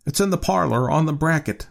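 put-on high-pitched, puzzled old man; marveling. A narrator's reading. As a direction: answer in mild bewilderment.

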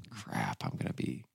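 soft low breathed realization; muttered dismay. Retold or imitated. Mom spotting the clash in shoot dates, muttered. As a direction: mutter it under your breath. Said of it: "I realized".

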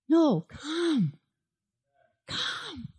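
gentle invitation. Shown as a demonstration. Pastor shows the Spirit's gentle invitation, soft and tender. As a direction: coax them in with a hushed welcome. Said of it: "No, not that kind of w-, invite".

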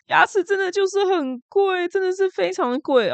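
high, put-on squeal about the cost; exaggerated whine. Real, not acted. Vlogger griping to viewers about teeth prices, exaggerated. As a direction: overplay how pricey it is.

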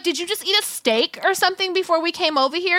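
mock interrogation, pitched high; incredulous confrontation. Storyteller confronting the man in retelling, imitated incredulous questioning. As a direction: act out a high-pitched, disbelieving demand.

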